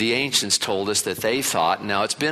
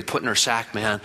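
ordinary delivery

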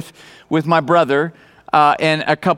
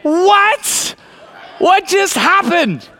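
a high, astonished cry of what, the second line slower; stunned disbelief; 12 semitones higher, much slower than usual. Retold or imitated. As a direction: sound like you just saw a miracle. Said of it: "I'm like".

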